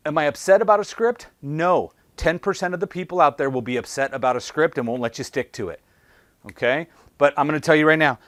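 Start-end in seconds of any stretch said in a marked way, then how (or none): none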